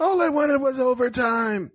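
high, shouted mock lament; mock henchman. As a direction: complain like a dying worker. Henchman riff.

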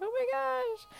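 high-pitched mock gasp of discovery; retold surprise. Mom recalling finding the cord in the pajamas, surprised.

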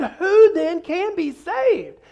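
high, loud, stretched-out question; hammed-up bewildered plea; astonished. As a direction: wail it slowly in mock disbelief. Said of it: "the disciples were astonished".

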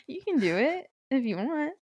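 high, rising, overly sweet cheerleading; high-pitched encouragement. Pretend. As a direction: cheer them on in a high sing-song. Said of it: "it doesn't sound real the way you're saying it".

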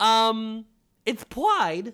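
high cartoon king voice; King Candy. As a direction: chirp it in character. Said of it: "I sound like the Mad Hatter".

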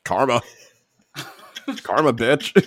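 laughing gloat; smug, laughing karma jab. Real, not acted. Gloating over injured rival.

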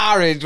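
strained, laughing orange; pained orange. Retold or imitated. Mocking the cousin, a pained laughing Orange. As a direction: Orange squeezed out as if it hurts. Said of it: "it sounds like it hurts".

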